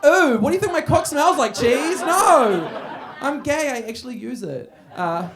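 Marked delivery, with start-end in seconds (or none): 0.0-2.0 higher, louder mock-teasing question; loud cheeky taunt to a front-row guy. Real, not acted. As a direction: bait the crowd member playfully. Mocking crowd member loudly.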